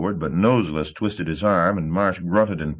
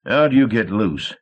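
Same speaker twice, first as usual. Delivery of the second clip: demanding; 4 semitones higher, 4 dB louder than usual. A narrator's reading. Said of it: "he demanded".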